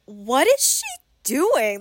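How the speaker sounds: slow, drawn-out puzzled question; mock bafflement. Imagined onlooker watching her act unhinged, drawn-out baffled disbelief. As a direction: act out a confused bystander, stretching the words.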